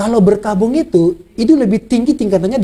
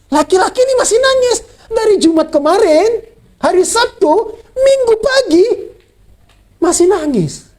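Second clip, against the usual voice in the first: rising preaching fervour; 11 semitones higher than usual. Acted. Preaching climax on mourning.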